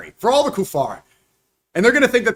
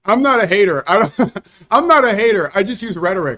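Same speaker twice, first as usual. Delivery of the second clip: laughing sarcastic; 3 semitones higher, 5 dB louder than usual. Real, not acted.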